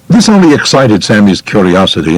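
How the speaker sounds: usual speaking voice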